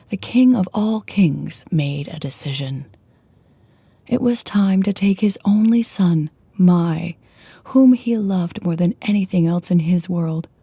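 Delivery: ordinary delivery, nothing marked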